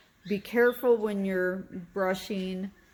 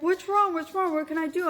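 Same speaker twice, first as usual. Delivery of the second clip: high, urgent, repeated pleading; imitating the panicked husband asking; husband's worried question; 11 semitones higher, 3 dB louder, much faster than usual. Husband bursting in on his crying wife, high and urgent. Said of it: "he's like".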